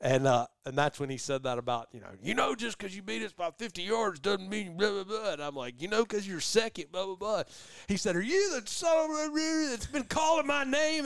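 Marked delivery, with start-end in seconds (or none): 2.2-5.3 higher mocking voice; higher sneering mimicry; mocking rival. 5.8-7.4 higher mocking voice; higher sneering mimicry; mocking retort. 8.2-11.1 high, mock-indignant voice; higher mock confrontation; confronting rival. Confrontation between hunters.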